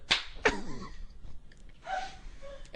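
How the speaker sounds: airy high laugh; breathless laugh. Real, not acted. Big laugh.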